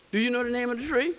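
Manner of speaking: usual speaking voice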